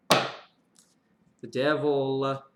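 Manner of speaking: slow, ominous drawn-out name; ominous drawl. Real, not acted. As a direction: stretch it out like a spooky reveal. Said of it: "I don't know why I said it like that".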